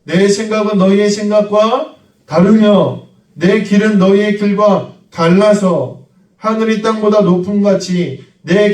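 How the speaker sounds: preacher intoning Isaiah's verse to the church; solemn scripture reading. Acted. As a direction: proclaim God's words, heavy and measured. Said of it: "여호와의 말씀에".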